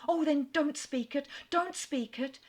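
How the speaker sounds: high, quick, pleading rush of words; eager cry. A narrator's reading. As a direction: beg him to stop, eagerly. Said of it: "she eagerly cried".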